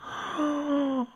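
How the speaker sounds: sharp high gasp of shock; a sudden startled intake of breath. Real, not acted. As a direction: gasp as if you can't believe it. Shocked Sim cries asleep.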